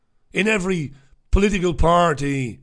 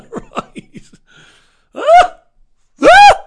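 high, loud laugh burst; real laughing fit, very high; 14 dB louder, much slower than usual. Real, not acted. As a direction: crack up laughing.

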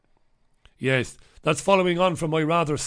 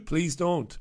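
normal talking voice

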